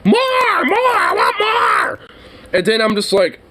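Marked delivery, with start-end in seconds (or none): 0.1-2.0 very high, slow, shrieking demand; mock freak-out shout. Worker imitates the lady yelling for more mayo, shrill mock shout. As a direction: screech it like a tantrum over mayo. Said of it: "she literally freaks out going".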